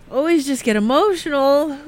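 real tears catching in the throat; getting emotional. Real, not acted. Radio guest talking about a friend's death, voice breaking. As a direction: voice breaking into a tearful whisper, then pitching up. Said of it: "I'm just gonna get emotional".

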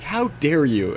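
mock outrage. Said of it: "John, being like".

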